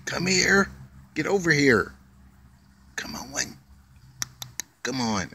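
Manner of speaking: high, sing-song calling to a squirrel; coaxing pet talk. Real, not acted. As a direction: coax the little animal over, pitch way up.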